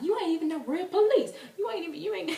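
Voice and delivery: high, loud mocking yell; indignant high-pitched shouting, acted out; yelling at police. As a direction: yell out a sassy challenge at fake cops. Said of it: "we started yelling at the police".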